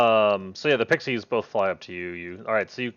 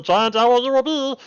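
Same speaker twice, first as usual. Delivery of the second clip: high, loud pixie casting; gleeful pixie spell cry; 10 semitones higher, 7 dB louder than usual. Acted. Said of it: "and goes".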